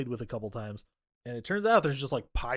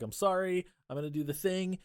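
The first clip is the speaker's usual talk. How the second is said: higher-pitched retold apology; mock pleading; 6 semitones higher than usual. Girlfriend apologizing to boyfriend, mildly pleading. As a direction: plead lightly in a raised voice.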